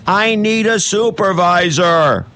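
high, drawn-out mock yell; imitated yelling. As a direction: mimic a guy yelling for help, pitch way up. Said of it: "yells at the top of his lungs".

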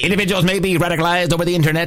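put-on fast, punchy old-time announcer; old newsreel. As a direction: rattle it off like a newsreel. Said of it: "old-time, uh, movie newsreel announcers".

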